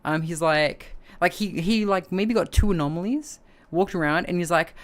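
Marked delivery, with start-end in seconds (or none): none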